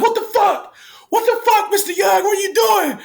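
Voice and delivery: high, loud shocked shouting; shocked kids shouting. Retold or imitated. Kids yelling at their departing staff member in shock. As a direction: yell it like stunned teenagers.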